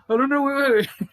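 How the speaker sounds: high loud urgent stopping; panicked wait. Retold or imitated. Stopping the girl before she leaves, loud panic. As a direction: call her back in a panic.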